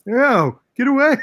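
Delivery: high, loud playful squeal; mock alarm. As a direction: squeal it in pretend panic. Mock recoil from the lyric.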